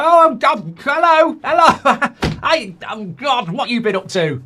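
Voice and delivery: high, loud, forced-cheery hellos with a laugh; awkward returning guest. Retold or imitated. Estranged relative walks into the family party, forced cheery hellos. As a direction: greet everyone too brightly, covering the awkwardness. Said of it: "they walk through the door and go".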